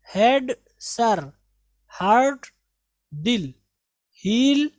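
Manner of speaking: usual speaking voice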